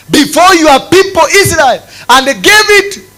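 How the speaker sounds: loud, high sermon delivery; full-force preaching. Acted. Preacher reminding God of Israel's land, in loud fervent preaching.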